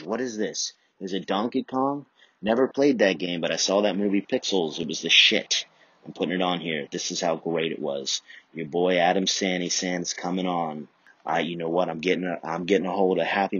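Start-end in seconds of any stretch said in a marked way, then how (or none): none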